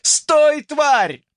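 high, loud shout at the thief; angry yell. Acted. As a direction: yell after him in anger.

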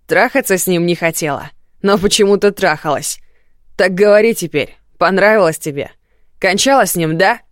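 громкая, яростная издёвка; дави вопросами со злостью; злой крик. Acted. Муж душит и допрашивает жену. (яростный крик)

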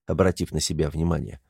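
ordinary delivery

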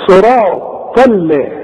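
"صراط ال-" slow, thin l after heavy ṭa; light lam. Shown as a demonstration. Teacher models correction, slow thin l after "صراط". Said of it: "إنما عدا ذلك مرققة".

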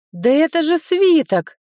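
high, loud excited cry; shouting. Acted. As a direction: burst out with discovery. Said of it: "закричала я на всю комнату".

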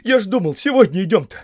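high, loud, flustered; agitated, keen explanation; eager excitement. A narrator's reading. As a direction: blurt it out while rushing off. Sam eagerly rushing to pack.

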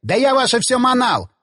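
high-pitched, exasperated outburst; fed-up exclamation. A narrator's reading. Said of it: "воскликнул Засоль".

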